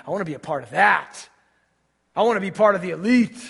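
loud, high fervent preaching; preaching at full force. Acted. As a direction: build the sermon to a loud climax.

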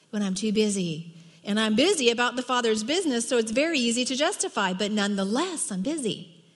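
ordinary talk

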